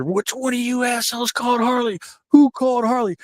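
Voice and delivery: imitating an angry brute hunting someone; mock raging. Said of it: "Vader was down in the lobby raging".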